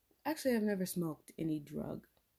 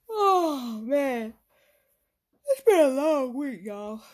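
weary groan; 6 semitones higher, 8 dB louder, slower than usual. Real, not acted. Sighing about a long week.

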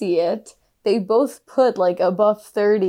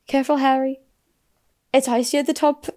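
lightly imitated lilting accent, pitched up; a hint of the film girl's accent, higher; Cho Chang, light accent; 5 semitones higher than usual. She turns around to warn Harry, lightly imitated accent. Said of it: "I think it's Irish accent".

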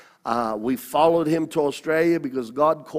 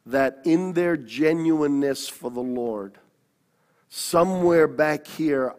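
ordinary talk

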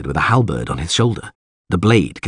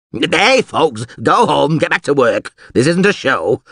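higher-pitched order to a crowd; calling out; 6 semitones higher, 3 dB louder than usual. Acted. Alderman sending the crowd home, calling out. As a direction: shoo the onlookers away.

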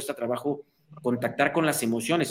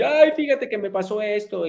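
lightly mock whimper; 6 semitones higher, 4 dB louder than usual. Crying person tells a problem.

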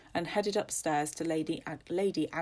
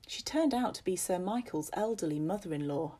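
ordinary delivery, nothing marked

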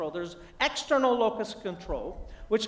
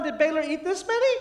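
raised-pitch retold parental questioning; mildly panicked dad; rising alarm; 6 semitones higher than usual. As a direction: check anxiously with a small child. Dad questioning toddler, growing alarm.

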